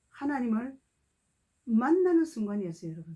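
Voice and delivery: ordinary talk, nothing marked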